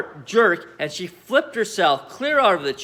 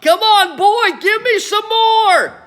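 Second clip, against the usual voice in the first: put-on high, loud, slow old-woman screech; greedy grandma yelling for more; demanding yell; 7 dB louder, slower than usual. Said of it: "she yelled".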